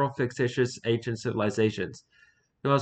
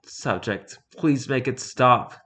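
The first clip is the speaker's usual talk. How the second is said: ordinary delivery, nothing marked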